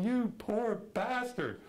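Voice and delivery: high, slow, choked-up pity; half-mock weepy sympathy; tearful pity. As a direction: feel for the heartbroken hero. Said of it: "I'm in tears".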